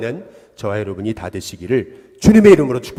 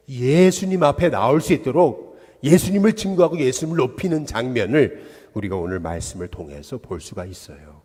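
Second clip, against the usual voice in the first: ordinary delivery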